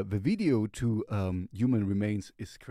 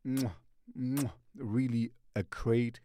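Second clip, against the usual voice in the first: between kisses. Real, not acted. Smacking kisses praising a song.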